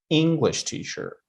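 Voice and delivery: "English teacher" with ENGLISH pitched high; exaggerated first-word stress. Shown as a demonstration. Teacher exaggerating so students understand, "English" stressed in "English teacher". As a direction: punch "English", let "teacher" fall away. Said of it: "trying to a little bit exaggerate".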